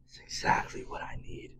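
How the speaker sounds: soft unvoiced whisper; whispered aside. [name] confiding in his brother, whispered. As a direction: whisper it to yourself.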